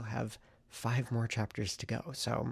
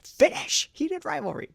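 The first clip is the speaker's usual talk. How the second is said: excited; 11 dB louder, slower than usual. Real, not acted. Vlogger listing today's goals.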